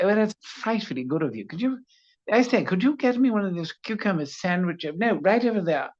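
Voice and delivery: high-pitched posh English voice; posh British accent. Shown as a demonstration. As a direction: snap into frightfully posh British. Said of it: "snap into the posh one".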